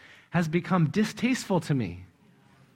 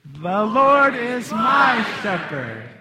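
MY held long and loud, slow line; stress on MY; 4 semitones higher, 6 dB louder, much slower than usual. Shown as a demonstration. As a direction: belt out a stretched my. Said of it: "repeat the words with a different emphasis each time".

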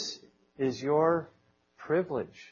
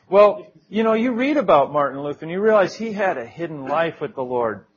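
normal talking voice

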